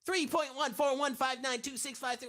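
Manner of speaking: high, loud, fast cartoon hedgehog voice; Sonic. Chat requested pi digits, he answers as Sonic. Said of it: "in Sonic's voice".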